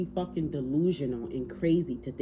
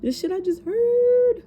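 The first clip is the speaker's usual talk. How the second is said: shocked exclamation; 7 dB louder than usual. Real, not acted. Reacting to a shocking message.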